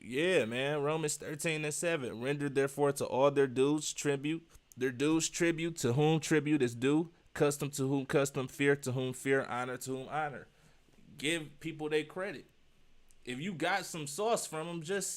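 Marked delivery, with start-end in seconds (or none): 2.2-10.5 scripture reading. Acted. Quoting Romans 13:7.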